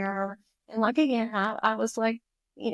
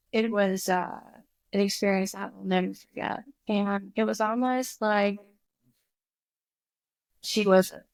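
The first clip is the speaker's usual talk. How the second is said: usual speaking voice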